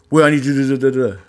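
mocking bossy voice. Someone bossing him around.

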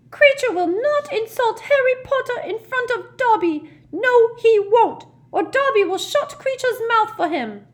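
high-pitched, loud house-elf cry; Dobby. Acted. House-elf defending Harry Potter mid-fight, high-pitched cry. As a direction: squeal it defiantly, very high. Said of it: "cried Dobby in a high-pitched voice".

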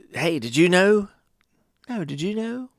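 put-on high, eager kid voice; imitated eager child. Kids exchanging information with cousins, imitated eagerness. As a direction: pitch it up like a child sharing a fact.

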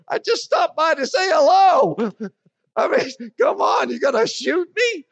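high alarmed protest. Retold or imitated. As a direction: protest that you only came to say hello. Pleading with the angry caller.

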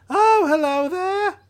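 high, eager drawl voice, thrilled to meet the pilot; excited alien Trixel. Acted. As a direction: greet him like an overeager little alien.

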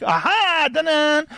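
high, showy ta-da; stage magician. Acted. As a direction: present the trick to the crowd. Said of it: "and he's like".

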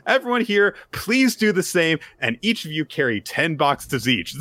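high, loud cheery cheerleading; pep them on with beaming zeal; chirpy Yor. Retold or imitated. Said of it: "And she stacks up".

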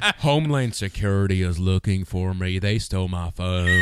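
deadpan mimicry, dragging out the last word; Forrest Gump. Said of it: "guy who talked like Forrest Gump".

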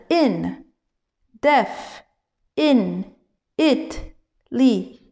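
in, def, in, it, li as separate beats with pauses; syllables from the start. Shown as a demonstration. Teacher showing the wrong forward drill, syllable by isolated syllable. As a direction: chop indefinitely into isolated syllables, front to back. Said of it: "si tu commences dans l'autre sens".

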